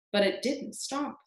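usual speaking voice

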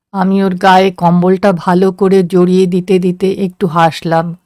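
ordinary delivery, nothing marked

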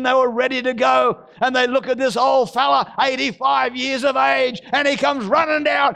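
fired-up revival preacher; full-force preaching. Acted. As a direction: preach it hard to the church. Preaching to church congregation.

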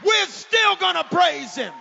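high, very loud cry; full-force pulpit shout; preaching shout. Real, not acted. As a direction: make the crowd shout it back. Shouting congregation call.

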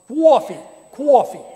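loud, high New York "coffee" with a kw glide, "kwaw-fee"; Beacon, New York accent. Shown as a demonstration. Showing how they say it up here, exaggerated New York accent. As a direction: punch out "cwoffee" like a New Yorker. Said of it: "That's how they say it in Beacon".

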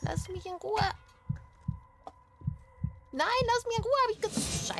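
high desperate pleading; frightened shriek. Real, not acted. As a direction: shriek at the ghost to go away. Still chased by ghost.

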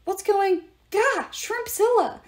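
high, slow startled yell. A narrator's reading. Percy yelling on seeing the monster, startled. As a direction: cry out in shock at the monster. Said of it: "Percy yelled".

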